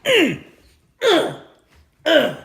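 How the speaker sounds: high, loud mock grunts; imitated emotional grunts. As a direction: grunt in short, exaggerated bursts. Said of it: "they use these emotions".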